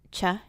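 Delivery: "cha" as ch plus a short uh; Old English CH sound. Shown as a demonstration. Teacher demonstrating Old English CH, short "cha". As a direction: sound out "cha" like the start of chug. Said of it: "C H will say".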